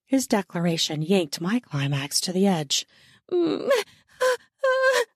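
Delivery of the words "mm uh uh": very high breaking moans. Acted. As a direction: moan without words, high and urgent. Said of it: "I moaned".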